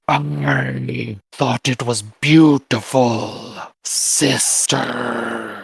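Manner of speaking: very slow, drawn-out demonic rasp; demonic raspy voice. Acted. Demon brother praising his sister's singing, slow and raspy. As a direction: stretch the words in a raspy growl. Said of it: "that demonic, raspy voice say".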